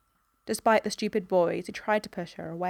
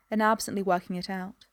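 usual speaking voice

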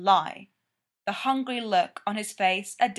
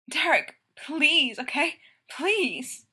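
high, pleading and desperate; begging; 6 semitones higher, slower than usual. A narrator's reading. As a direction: beg him, voice pitched up. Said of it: "Stiles begs".

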